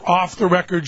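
usual speaking voice

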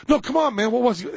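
acted insistent prodding. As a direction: play a friend pressing for an answer. Acted friends pressing for an answer.

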